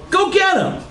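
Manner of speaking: very high-pitched command; hammed-up high order; urgent order. A narrator's reading. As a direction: send him off in a high cry. Margaret ordering Charlie off.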